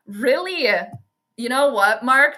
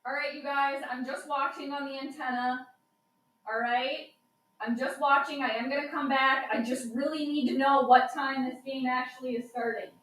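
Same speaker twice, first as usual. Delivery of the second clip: distant and softer, off in another room; away from the mic; 7 dB quieter than usual. Real, not acted. Streamer stepping away to watch the antenna, distant. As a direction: talk from across the house, quieter. Calling from the TV out back.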